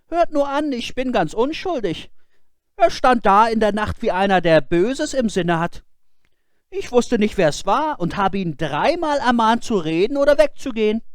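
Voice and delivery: put-on high, earnest boyish voice; boy's voice. Boy explaining himself to his scolding father, high and earnest. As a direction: plead innocence.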